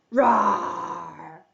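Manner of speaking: low, drawn-out play roar; lion roar. Pretend. Storyteller plays the lion facing the mouse, low roar.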